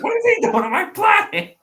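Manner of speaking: very high, loud comic outrage; Beerus in mock outrage. Beerus finds Broly on his planet, mock outrage. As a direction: squawk it in mock indignation.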